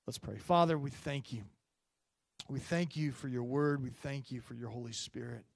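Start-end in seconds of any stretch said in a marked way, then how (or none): none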